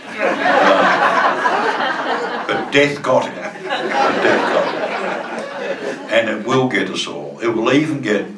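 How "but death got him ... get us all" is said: ordinary talk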